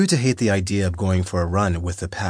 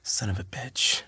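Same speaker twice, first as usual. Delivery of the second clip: soft, pained curse, much softer; hissed in pain; 3 semitones higher, 7 dB quieter than usual. A narrator's reading. Injured man cursing after trying to sit up, softly pained. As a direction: wince it out through the pain. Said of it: "but hissed in pain".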